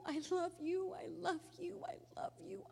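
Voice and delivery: high, breaking voice fading to a whisper; tearful goodbye. Retold or imitated. Daughter telling her dying dad she loves him, crying and whispering. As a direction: sob out the goodbye.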